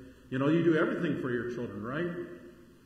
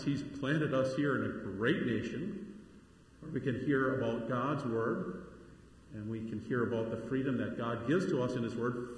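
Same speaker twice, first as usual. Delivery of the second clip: normal talking voice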